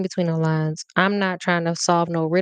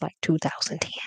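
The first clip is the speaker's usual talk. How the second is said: whisper; 3 semitones lower, 6 dB quieter than usual. Real, not acted. Embarrassed aside about old photos.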